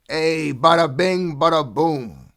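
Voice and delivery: high, drawn-out mobster bada bing; mock Brooklyn gangster flourish; mobster exclamation. Shown as a demonstration. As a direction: belt it like a wiseguy showing off. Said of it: "I should say it like that when I say it".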